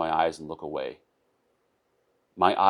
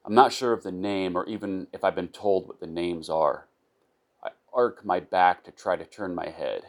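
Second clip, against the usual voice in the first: ordinary delivery, nothing marked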